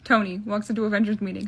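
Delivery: ordinary delivery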